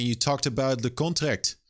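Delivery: normal talking voice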